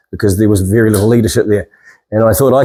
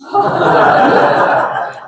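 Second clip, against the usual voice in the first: laughing. Real, not acted. Laughs at worm comment.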